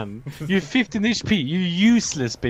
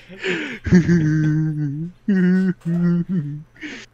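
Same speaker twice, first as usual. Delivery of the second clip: mocking laugh imitation; 2 semitones lower than usual. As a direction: mimic his laugh in short repeated hoots. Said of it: "That's how he sounds".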